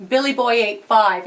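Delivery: ordinary delivery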